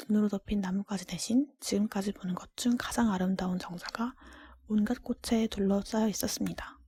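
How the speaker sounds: ordinary delivery, nothing marked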